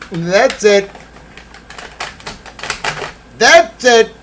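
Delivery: high loud gotcha; triumphant realization. Acted. Streamer proving his memory right. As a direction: declare it like a victory.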